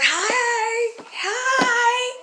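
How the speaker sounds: eager greeting. Retold or imitated. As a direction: chirp hello like an eager kid. Child greeting aliens in class.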